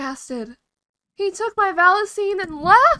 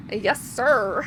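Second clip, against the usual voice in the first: ordinary talk, nothing marked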